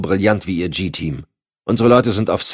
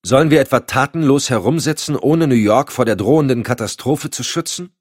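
louder, higher indignant challenge; indignant, raised-voice rhetorical question; blustering; 8 semitones higher, 4 dB louder than usual. A narrator's reading. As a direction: bluster in protest at doing nothing. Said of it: "polterte der Vertreter der Homeland Security".

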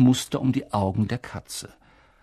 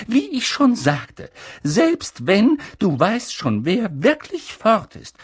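McGonagall voice; 9 semitones higher, 5 dB louder than usual. Acted. McGonagall insisting.

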